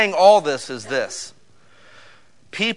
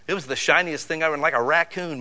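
normal talking voice

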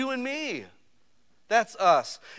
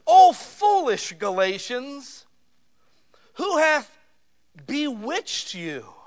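slow, much louder, higher rebuke from the pulpit; thundering scripture reading; 7 semitones higher, 6 dB louder, much slower than usual. Acted. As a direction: call out Paul's words like a stern apostle. Preacher reads Paul's rebuke.

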